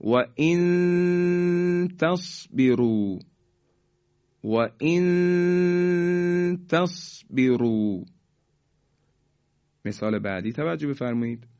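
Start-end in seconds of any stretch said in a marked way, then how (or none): 0.0-8.0 و ان تصبروا with a long high hum on ان before ت; ikhfa recitation. Shown as a demonstration. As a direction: recite و ان تصبروا slowly, hiding the n in a held nasal tone.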